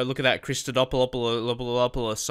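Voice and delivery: Christodopoulos stumbled over with repeated syllables; drawn-out mangled Christodopoulos; fumbled name. Real, not acted. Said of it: "I've no idea how to say that name".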